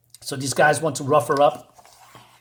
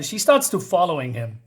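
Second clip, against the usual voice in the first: usual speaking voice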